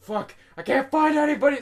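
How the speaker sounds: high, loud panicked yell; hammed-up frantic outburst; freaking out. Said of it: "I was freaking out again".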